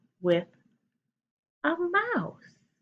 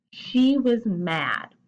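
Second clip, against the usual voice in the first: slow read-aloud; much slower than usual. Shown as a demonstration. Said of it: "reading sentence aloud slowly".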